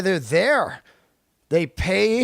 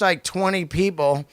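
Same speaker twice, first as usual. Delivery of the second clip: usual speaking voice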